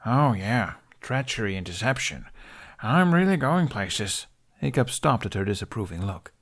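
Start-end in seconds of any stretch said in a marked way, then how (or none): 0.0-4.3 sarcastic. Acted. Sarcastic self-mockery.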